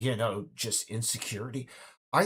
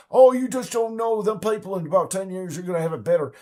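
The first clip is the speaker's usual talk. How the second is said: higher, louder hammed-up Southern accent, rushing on; mock Southern accent; 7 semitones higher, 9 dB louder than usual. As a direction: play a smug Southern war fan. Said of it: "I'm giving him a Southern accent".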